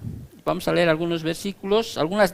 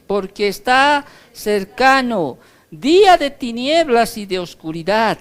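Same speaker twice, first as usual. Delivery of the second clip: scripture reading; 3 semitones higher, 4 dB louder than usual. Acted. Preacher reading the warning verse aloud to the church.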